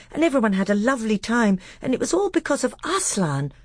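put-on high, eager little boy telling a tale; breathless childish enthusiasm, babbling on; Caspian. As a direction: innocent small-boy storyteller. Caspian babbles on to his uncle.

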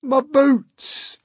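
high, loud dismayed cry; hammed-up centipede outcry; cried. A narrator's reading. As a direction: wail in horror over a loss. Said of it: "cried the centipede".

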